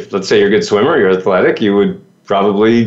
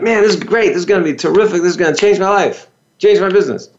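bright, higher, eager gush about notes; mock excitement; 6 semitones higher, faster than usual. Seminar-goer home from an event, imitating excited gushing. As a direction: play the seminar fan, all fired up. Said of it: "you're all excited".

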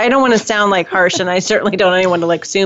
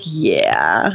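sarcastic. Retold or imitated. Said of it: "And I'm like".

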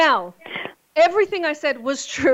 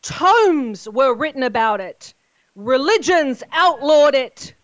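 booming ringmaster announcement, slow and loud; 5 semitones higher, 3 dB louder, slower than usual. Acted. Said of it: "Ladies and gentlemen, boys and girls, children of all ages".

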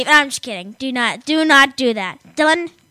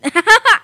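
high laugh; 8 semitones higher, 7 dB louder than usual. Real, not acted. Laugh at joke.

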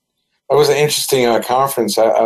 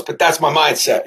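usual speaking voice

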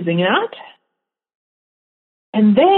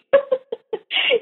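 high laugh; 4 dB quieter than usual. Real, not acted. Laughs after who knows.

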